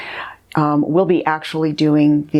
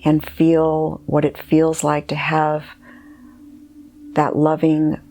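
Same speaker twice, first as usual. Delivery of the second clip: guided meditation. Acted. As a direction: guide the listener gently inward, leaving space between phrases.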